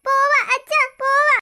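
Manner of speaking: put-on wailing small child refusing school; noisy child protest. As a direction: cry out in alarm, begging to leave. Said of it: "നീ എന്തിനാ ബഹളം വെക്കുന്നേ". (ബഹളം വെച്ച് കരയുന്ന കുട്ടി)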